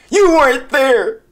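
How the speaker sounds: high, slow mocking copy; mocking echo. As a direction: parrot him back, teasing. Mocks the video's line.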